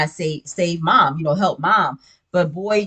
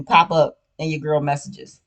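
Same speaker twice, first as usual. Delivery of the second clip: ordinary talk, nothing marked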